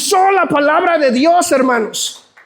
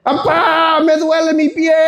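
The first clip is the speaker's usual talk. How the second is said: hammed-up whimper of a grown man calling his parents; mock sobbing to mom and dad, half laughing; mock crying grown child; slower than usual. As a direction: play a twenty-year-old bawling like a toddler. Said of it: "empiezo a llorar y le digo".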